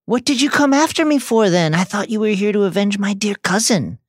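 high, mocking sneer; taunting witch. A narrator's reading. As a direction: tease him from the shadows with a high voice. Bellatrix taunting Harry.

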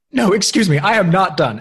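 loud, high, indignant protest; mock indignant shout. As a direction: shout it like you refuse to be cut off. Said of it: "Alex goes".

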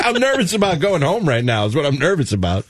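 usual speaking voice

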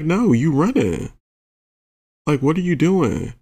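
high-pitched, swooping protest; exasperated scolding. Retold or imitated. Teller scolding a partner who keeps running, exasperated.

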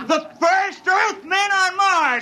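a crewman toasts the first Earthmen on Mars, laughing and shouting; drunken laughing shout. Acted. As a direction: laugh it out like you're already drunk. Drunken crewman celebrating landing.

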